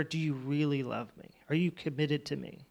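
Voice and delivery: hushed, low acted plea; quietly voiced earnest question; soft, searching question. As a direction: ask it softly and intimately, as a retold question. Voices Jesus questioning Peter earnestly.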